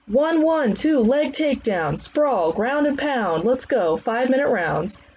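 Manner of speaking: high, projected commands; trainer's drill calls. Acted. Trainer starting five-minute rounds, high called commands. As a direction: call out the combo to the class.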